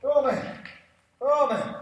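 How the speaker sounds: loud high "oh man"; exasperated exclaim. Real, not acted. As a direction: blurt it out, frustrated. Exclaims over sliding bench.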